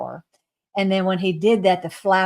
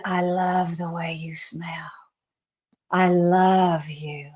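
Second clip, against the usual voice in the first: slow, breathy whisper of love; tender whisper; 3 dB quieter, slower than usual. Retold or imitated. Jesus hugging the infant speaker, whispering softly. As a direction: whisper it like hugging a child close.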